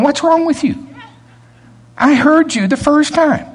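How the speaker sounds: exasperated father. Retold or imitated. Father fed up with his nagging child, exasperated and high. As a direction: snap back like a worn-out dad.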